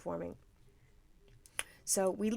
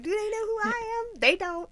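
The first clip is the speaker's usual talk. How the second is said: high-pitched pretend inner voice; mock inner diva; 12 semitones higher than usual. Wife standing unnoticed beside husband and fan, mock inner diva. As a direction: squeak it out as a mock-wounded thought.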